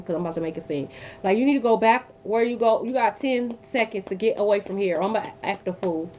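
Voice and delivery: usual speaking voice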